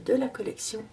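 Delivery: ordinary talk, nothing marked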